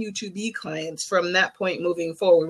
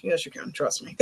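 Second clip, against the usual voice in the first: soft reassurance; 9 dB quieter than usual. Real, not acted.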